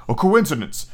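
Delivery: ordinary delivery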